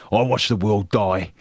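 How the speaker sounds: Brummie soldier voice, rising; gruff Birmingham accent. Shown as a demonstration. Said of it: "I did the Brummie accent".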